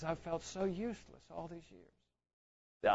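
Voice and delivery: hushed, slow, defeated retelling; very soft and drawn out, voicing a weeping man's despair; retold tearful confession. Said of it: "begins to weep and weep".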